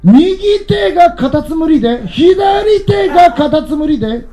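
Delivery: put-on high, childlike chant of the punchline; little girl's voice. The girl rushes her hand game to its end, in a high childlike chant. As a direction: blurt it out like an impatient toddler.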